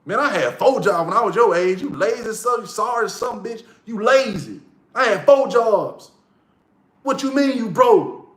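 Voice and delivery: high, hammed-up scolding; imitated trash talk. Homeboy shaming a younger lazy friend, mock berating. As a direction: imitate an older buddy chewing him out up high. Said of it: "That's how they talk to you".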